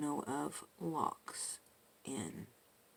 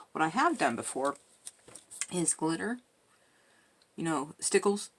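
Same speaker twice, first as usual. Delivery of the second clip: louder burst on the word stickles; sudden yell; 7 dB louder than usual. Real, not acted. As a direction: blurt it out loud without meaning to. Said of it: "suddenly yelled that".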